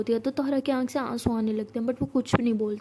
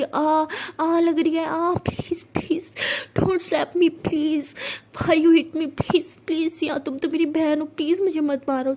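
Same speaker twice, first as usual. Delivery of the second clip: fake pained screaming; 4 semitones higher, slower than usual. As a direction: overplay the pain to fool everyone. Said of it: "ज़ोर ज़ोर से चिल्ला रही थी".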